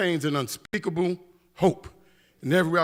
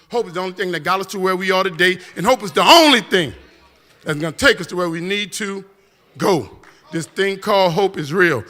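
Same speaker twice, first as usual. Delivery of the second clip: building preaching refrain; 6 dB louder than usual. Acted.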